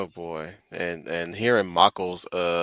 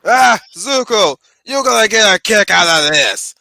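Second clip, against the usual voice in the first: Iroh voice; 10 semitones higher, 10 dB louder than usual. Retold or imitated. Voicing Iroh to Zuko.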